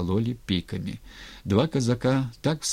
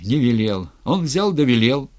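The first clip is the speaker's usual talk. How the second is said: louder, bitter retort; bitter exclamation; 4 semitones higher, 6 dB louder than usual. A narrator's reading. Matvey retorting to the ataman's denial, bitter exclamation. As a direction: throw it back as a reproach. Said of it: "горько воскликнул Матвей".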